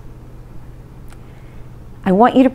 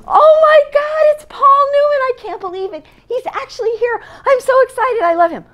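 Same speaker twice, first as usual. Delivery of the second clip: much higher, gushing fan voice, breathless with delight; starstruck squeal. Retold or imitated. Barbara spotting Paul Newman, high starstruck gush. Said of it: "She goes".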